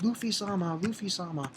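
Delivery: soft, faint call for help; understated plea, voice held back; imitated calling out. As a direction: call the name softly, as a timid princess. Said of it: "she starts calling Luffy's name".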